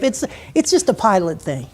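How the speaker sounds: ordinary talk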